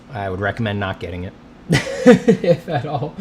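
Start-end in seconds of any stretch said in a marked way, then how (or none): 1.5-3.1 laughing. Real, not acted. Laughs while advising against covid.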